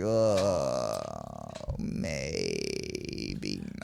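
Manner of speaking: very low, soft, dragged-out mock reluctance; comically deep, hushed backing out; reluctant hedging. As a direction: sink right down into a hesitant groan. Acted reluctant reply to buddy.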